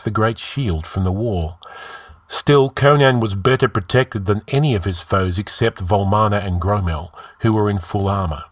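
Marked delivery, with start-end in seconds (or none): none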